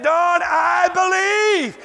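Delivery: high, very slow, overcome; every word wrung out in awe; wife on her knees, slow and high. Retold or imitated. Wife's tearful confession.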